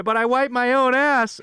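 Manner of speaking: high, loud, drawn-out yell; movie quote yell. Acted. Host blurts out a film quote, yelled high. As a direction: yell it slowly like an indignant movie line. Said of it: "another Happy Gilmore quote for you".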